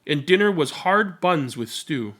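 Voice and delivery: gentle read-aloud storytelling voice; story narration. A narrator's reading. Narrator reading a picture book to listeners, gentle storytelling.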